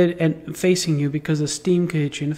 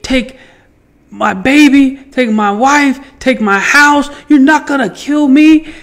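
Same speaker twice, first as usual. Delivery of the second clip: put-on "take my baby, take my wife, take my house" yelled loud and high; defiant shout; 6 semitones higher, 6 dB louder than usual. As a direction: roar each "take" at the fire. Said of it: "I swore and I spoke to the damn thing".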